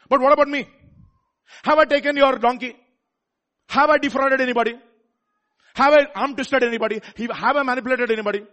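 loud, high, righteous demand; indignant protest. Acted. Samuel challenging the elders, loud and indignant. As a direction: fling each question back at them, loudly.

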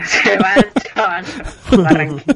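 laughing narration. Retold or imitated. Repeating the video's narration, laughing.

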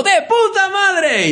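put-on very high, slow, gleeful kid shout of "¡De puta madre!". Children leaving the cinema, shouting the swear word. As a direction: yell it like a thrilled five-year-old. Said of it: "salimos todos los niños ... diciendo".

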